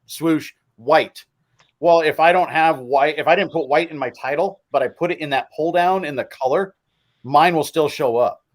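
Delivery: usual speaking voice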